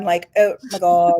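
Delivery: put-on slow, drawn-out Valley Girl "oh my God"; lightly done Valley Girl gasp. Shown as a demonstration. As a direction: play up a California Valley Girl. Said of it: "I could put my Valley Girl on".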